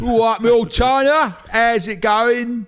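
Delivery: high, loud, hammed-up Cockney; Cockney accent. Podcaster inviting an old China to darts, exaggerated Cockney. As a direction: holler it in broad, drawn-out Cockney. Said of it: "Cockney from London".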